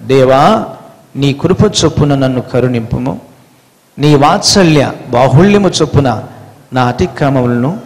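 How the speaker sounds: low, soft, pleading prayer; hushed penitent prayer to God; prayerful psalm reading. Acted. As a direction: pray the psalm humbly and quietly.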